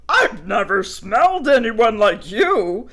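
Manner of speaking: loud, sniffing dog; nosy pup; curious dog voice. Acted. Picket curious.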